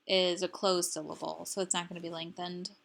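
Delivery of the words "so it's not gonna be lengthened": ordinary delivery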